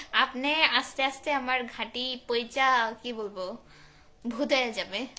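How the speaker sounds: normal talking voice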